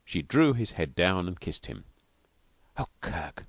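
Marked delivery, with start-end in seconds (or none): 2.8-3.4 very soft, lower murmur; whispered. A narrator's reading. Wife to husband after kissing him, whispered. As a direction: breathe the name very softly. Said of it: "she whispered".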